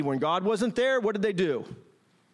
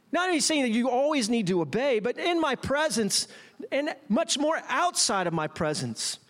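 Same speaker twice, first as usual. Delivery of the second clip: ordinary talk, nothing marked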